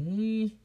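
high rising "mm"; unsure, drawn-up mm; doubtful hum. Real, not acted. Doubtful verdict on audio quality.

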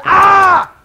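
high loud shout of fright; mock terrified yelp; frightened cry. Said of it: "He was shaking".

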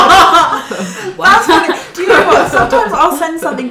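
words breaking through real laughter, high and loud; laughing. Real, not acted. Friend laughing about her mum's texts, talking through loud laughter. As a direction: laugh helplessly while getting the words out.

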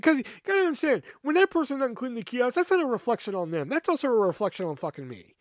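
high-pitched, worked-up venting; furious rant. Real, not acted. Teller explaining why the messy kiosk reflects badly, indignant rant. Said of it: "I'm fucking furious".

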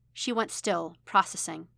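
plain reading voice

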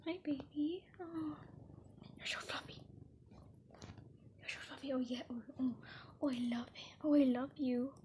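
soft, tender whisper to a fluffy cat. Real, not acted. Vlogger whispering lovingly to her cat, tender whisper. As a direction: coo softly at the purring cat.

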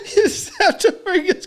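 high, broken-up narration through laughter; real giggles over every word; helpless laughing. Real, not acted. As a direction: try to keep telling it while cracking up. Trying to resume through laughter.

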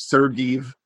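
normal talking voice

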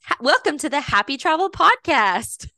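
put-on high, singsong child's podcast intro; imitating little girl. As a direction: announce it like a small girl playing host. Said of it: "she goes".

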